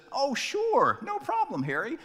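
very high, chirpy pastor; breezy, cheerful brush-off; mock-cheerful pastor. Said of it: "And the pastor says".

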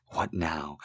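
breathy whispered rasp. A narrator's reading. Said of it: "she rasped".

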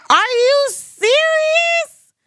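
very high, drawn-out squeal; slow, soaring astonished question; utter disbelief. Retold or imitated. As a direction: stretch it into an incredulous shriek. Retelling shock at the grant.

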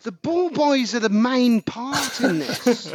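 slow, high-pitched exasperated emphasis; worked-up, drawn-out complaint; impassioned. Real, not acted. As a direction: hammer it out with real passion. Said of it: "The passion you're speaking to this about".

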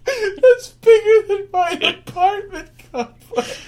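high loud laughing disbelief; laughing shock. Real, not acted. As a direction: laugh it out, stunned.

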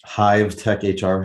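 "Hive Tech H R" slowed right down. Real, not acted. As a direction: say "Hive Tech H R" slowly, word by word. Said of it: "I have to say that slower".

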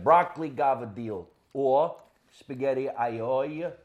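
"aglio" as eye-OY-ya and "gavadil" ending on deel, drawn out slowly; slow Italian-American dialect on "gavadil" and "aglio"; mother's dialect pronunciation. Shown as a demonstration. Son showing how his mother said it, "aglio" in her dialect. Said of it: "that's my mother's accent".

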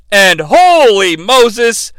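usual speaking voice

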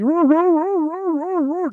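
rapid, high, loud woofing howls; bay like a hound giving chase; dog barking. Acted. Dog chasing around a tree, high excited barking. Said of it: "I'll sit there barking at it".

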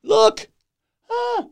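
high, strained mock shout up the hill; calling out to hippies. Man on the beach shouting up at partying hippies, high mock yell. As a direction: wave them over, yelling in a high voice. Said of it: "I'm like".

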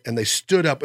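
ordinary talk

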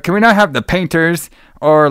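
ordinary talk

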